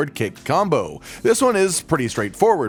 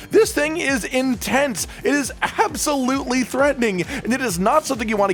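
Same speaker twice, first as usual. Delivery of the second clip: awed; 8 semitones higher than usual. Real, not acted. Hyping the heavy turret.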